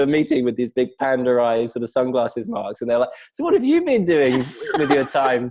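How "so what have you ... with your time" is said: mock teasing colleagues. Colleagues teasing about panda eyes.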